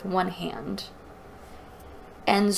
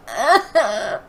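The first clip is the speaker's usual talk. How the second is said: laugh; 4 semitones higher, 6 dB louder than usual. Real, not acted. Reader laughing at Maggie.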